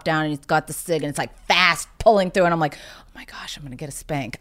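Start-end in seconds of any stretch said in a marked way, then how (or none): 2.8-4.4 put-on whispered in kid panic, breath only; panicked whisper. Ten-year-old dreading her dad, frightened whisper. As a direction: hiss it like a scared ten-year-old caught out.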